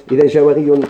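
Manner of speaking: ordinary talk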